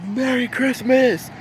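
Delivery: high, slow, stretched-out greeting; festive calling out. Retold or imitated. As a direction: imitate a jolly crowd's drawn-out cheer. Firefighters calling out from truck.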